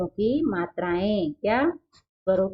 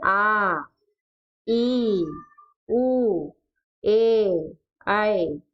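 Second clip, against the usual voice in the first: slow, stretched aa; long vowels drawn out; much slower than usual. Shown as a demonstration. Teacher stretching long vowels for the class. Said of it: "इसको ऊंची आवाज में या फिर जोर लगाकर बोला जाता है".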